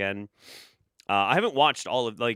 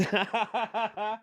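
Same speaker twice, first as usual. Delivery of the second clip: burst of laughter; 12 semitones higher than usual. Real, not acted. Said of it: "breaking into laughter".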